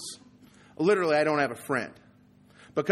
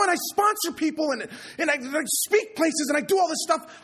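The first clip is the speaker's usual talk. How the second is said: railing at God; 11 semitones higher, 3 dB louder than usual. Retold or imitated. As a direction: hurl the complaint upward at God. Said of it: "and I'm blaming God".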